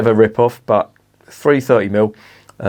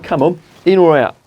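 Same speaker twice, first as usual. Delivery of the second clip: calling out; 7 semitones higher, 3 dB louder than usual. Real, not acted. Calling the dog at the door.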